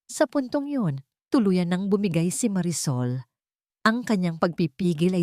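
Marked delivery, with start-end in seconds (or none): none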